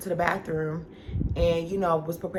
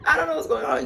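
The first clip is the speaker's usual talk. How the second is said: crying; 8 semitones higher, 4 dB louder, faster than usual. Real, not acted. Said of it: "I started breaking down crying".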